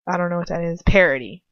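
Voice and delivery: ordinary talk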